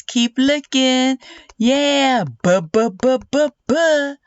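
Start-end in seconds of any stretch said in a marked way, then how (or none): 2.2-4.1 "b" chanted as a bouncy run of "buh"; letter B sound. Shown as a demonstration. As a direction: repeat "b" as "buh", climbing in pitch. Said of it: "looking for the b sound".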